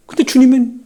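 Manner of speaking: ordinary talk, nothing marked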